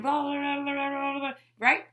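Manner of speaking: high, run-together mock yelling gibberish; mock arguing. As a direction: babble blah-blah like a shouting match. Mimics two people yelling at once.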